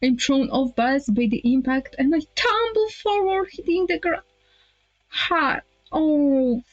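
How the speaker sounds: high-pitched dramatized tumble; dramatic alarm. Acted. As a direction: narrate the fall as it happens, pitched high. Acts out being knocked down.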